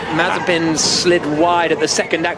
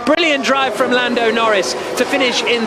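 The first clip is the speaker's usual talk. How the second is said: raised, driving finish-line commentary; live race-finish call; 4 semitones higher than usual. Acted. Commentator calling the winner across the line, excited. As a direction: call the win over the line with lift.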